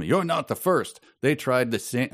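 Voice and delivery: put-on high, lightly French Canadian accent; a hint of a French Canadian columnist. Reader doing the columnist in a French Canadian accent. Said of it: "maybe my Canadian accent".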